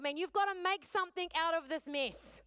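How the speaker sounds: high, loud, demanding cry; insist, like you're ordering God; demanding prayer. Retold or imitated. Woman demanding God fix her mess, loud high cry. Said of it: "And I remember saying to God".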